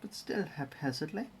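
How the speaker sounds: narrating each step in a hammed-up German accent; mock German accent with z for th; bad German accent. Streamer narrating his Lego build in a mock German accent. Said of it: "In a German accent, apparently".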